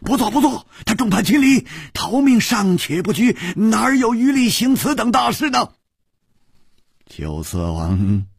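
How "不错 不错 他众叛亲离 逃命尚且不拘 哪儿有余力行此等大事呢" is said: high, agitated yelling; crying out. A narrator's reading. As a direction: chime in with a high-pitched shout. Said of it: "立刻也大叫道". (立刻高声大叫附和)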